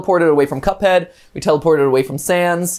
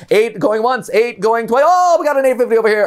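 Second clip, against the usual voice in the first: higher, fast auction patter; auctioneer; 5 semitones higher than usual. Shown as a demonstration. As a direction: rattle off bids like an auctioneer. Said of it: "Can you imitate an auctioneer?".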